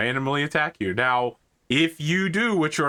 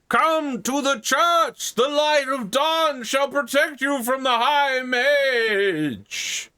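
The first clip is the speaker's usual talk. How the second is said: preaching priest; 11 semitones higher, 3 dB louder, slower than usual. Acted. GM voices preacher calling crowd.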